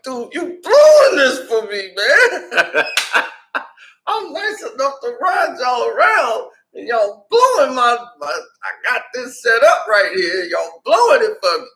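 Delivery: high, laughing protest; exasperated complaint. Retold or imitated. Driver to friends ruining his night, high exasperated pleading.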